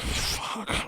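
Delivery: a beaten, barely voiced fuck; defeated whispered curse. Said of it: "And Zach's like".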